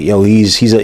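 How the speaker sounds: ordinary delivery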